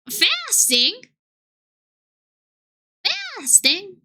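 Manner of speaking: high, questioning mock gasp of disbelief; mock surprise. Streamer reacting to the fasting wisp, high mock disbelief. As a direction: act scandalised it isn't eating.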